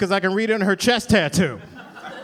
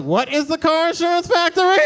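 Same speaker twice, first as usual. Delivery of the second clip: phrased as a game-show question, pitch up and rising at the end; mock quiz-show contestant, rising question; Jeopardy-style answer; 7 semitones higher than usual. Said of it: "We're not playing Jeopardy".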